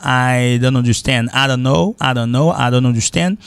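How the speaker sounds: "I don't understand", "I don't know" as "ah-dan-understand", "ah-dunno"; T dropped. Shown as a demonstration. Teacher drilling liaison, "don't" run on without its T. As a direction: link "don't" to the next word, no T. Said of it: "le T, T a pas prononcé".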